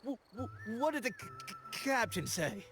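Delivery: high, stuttering pirate voice; stuttered, high-pitched question; stammering. Acted. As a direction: stammer on "what" and "captain", repeating the first sound. Eager stutterer asks about captain's news.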